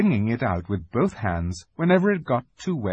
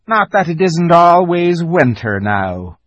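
slow, gloomy fawn voice, pitched up and louder; gloomily; 7 semitones higher, 6 dB louder than usual. Acted. The fawn laments the endless winter to Lucy, gloomy. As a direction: sigh it out like a fawn missing spring. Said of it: "he added gloomily".

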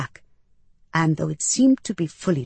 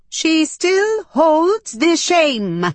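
high, loud, very slow alien voice; accusing alien; 11 semitones higher, 6 dB louder, much slower than usual. A narrator's reading. One alien near the door condemns her, accusing. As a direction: draw every word out loudly in a high creature voice. Hostile alien speaks of the narrator.

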